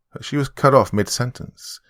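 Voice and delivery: ordinary delivery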